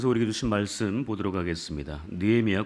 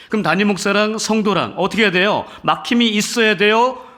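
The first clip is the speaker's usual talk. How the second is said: higher and louder, driving the point home; fervent preaching; 8 semitones higher, 7 dB louder than usual. Acted. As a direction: preach it with rising fire to the congregation. Pastor exhorting congregation.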